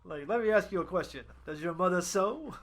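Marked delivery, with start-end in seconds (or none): none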